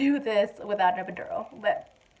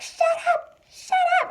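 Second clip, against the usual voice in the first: very high-pitched mock outburst; snapping. Wife in labour silencing her husband, high mock snapping. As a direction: imitate snapping, squealed twice. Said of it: "I finally just snapped".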